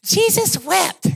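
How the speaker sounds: high, drawn-out, grandly declaimed recitation; boy reciting verse. Acted. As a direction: proclaim it slowly like a kid showing off a memorized verse. Boy proudly recites his short verse.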